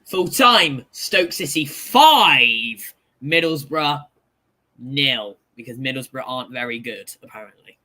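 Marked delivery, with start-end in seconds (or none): 0.1-5.4 mock results announcer. Reading a score like an announcer.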